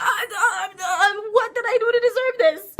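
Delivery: loud, high disbelieving shout; very high, slow loud "Excuse me"; shocked outrage. Retold or imitated. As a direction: yell out in mock horror. Shock at seeing him.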